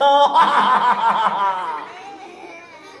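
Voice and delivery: booming villain laugh; evil wolf laugh. Acted. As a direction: cackle like a greedy wolf. Wolf laughs after threat.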